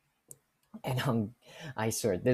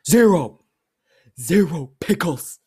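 very loud, higher, outraged announcement; mad customer; 4 semitones higher, 12 dB louder, slower than usual. Retold or imitated. Customer reporting missing pickles, acted angry. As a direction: blast the number like an irate customer. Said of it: "This guy's mad".

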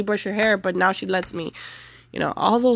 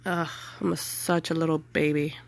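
choked up; 3 semitones lower, 6 dB quieter than usual. Real, not acted. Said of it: "I'm trying not to cry".

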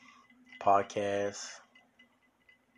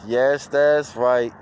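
ordinary talk

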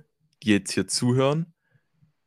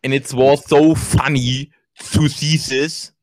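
slow, slushy lisp, louder; Mike Tyson impression; 2 semitones higher, 5 dB louder, much slower than usual. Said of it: "So redet Mike Tyson".

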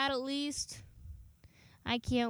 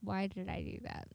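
ordinary talk

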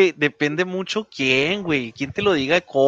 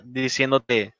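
ordinary delivery

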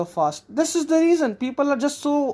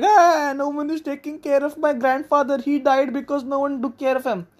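mock crying; 6 semitones higher than usual. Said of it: "This woman is crying and she's like".

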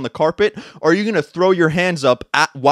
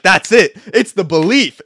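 loud, amped-up realization; fired up; 4 semitones higher, 8 dB louder than usual. Retold or imitated. Retelling his podcast revelation to viewers, fired up. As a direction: burst out with it, all fired up. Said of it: "I was fired up".

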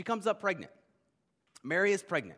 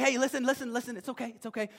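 higher-pitched acted reassurance; anxious placating; 8 semitones higher, faster than usual. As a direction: lightly play someone heading off a panic. Acted Mary soothing Joseph before confession.

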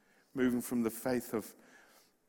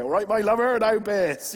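loud, high West Country greeting; Bristol council worker; 9 semitones higher, 12 dB louder than usual. Retold or imitated. Preacher mimics a council worker answering the phone in broad Bristol. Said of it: "So people answer the phone".

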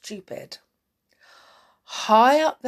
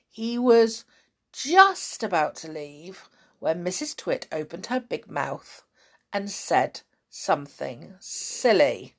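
plain reading voice

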